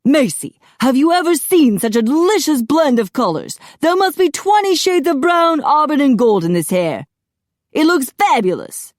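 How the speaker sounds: high, loud, effusive raving; gushing stylist. A narrator's reading. Apollo shows Macy Phil's hair, gushing high delight. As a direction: marvel theatrically over every word.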